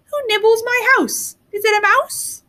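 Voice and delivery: put-on high, sing-song old woman's voice; gentle, amused old woman. The old woman calls from inside her house, gentle and amused. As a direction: tease the hidden nibblers kindly. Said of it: "gentle and amused".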